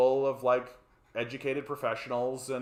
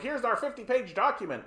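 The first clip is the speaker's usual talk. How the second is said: mock earnest NDP; 11 semitones higher than usual. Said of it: "the N D P are standing up and being like".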